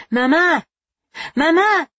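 put-on boy's high, loud call for mother. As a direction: shout across the dark to mama. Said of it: "calling and running toward her".

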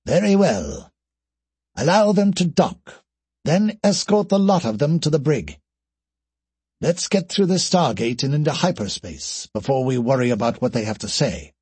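commanding character voice, high then settling; captain's decisive command in a put-on voice; alien captain. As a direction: give orders to the crew. Voices alien captain giving orders.

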